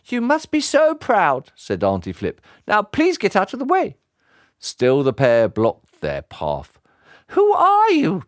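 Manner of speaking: put-on high, indignant old lady voice; sharp old lady. Aunt snapping at the henchmen, indignant. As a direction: put them in their place.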